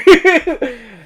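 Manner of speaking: high, loud burst of laughter. Real, not acted. Host cracking up at own retelling, laughing.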